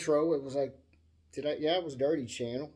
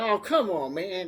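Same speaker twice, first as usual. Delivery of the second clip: raised, louder exasperated objection; retold protest; 4 semitones higher, 7 dB louder than usual. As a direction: protest a friend's teasing. Acted protest at friend's teasing.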